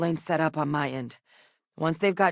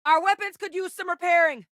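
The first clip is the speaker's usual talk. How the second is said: high, strained combat call; 13 semitones higher, 3 dB louder than usual. Acted. Companion to captain about weapons needing repair, calling out. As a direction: raise your voice well above the fray. Combat bark in game lines.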